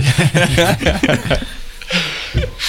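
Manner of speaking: high-pitched laughter. Real, not acted. Laughing at own quote.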